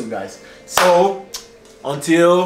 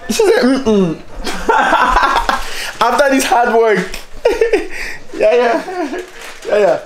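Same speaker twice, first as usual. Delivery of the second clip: giggling high between words; laughing; 7 semitones higher than usual. Real, not acted. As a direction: laugh your way through it. Laughing through the story.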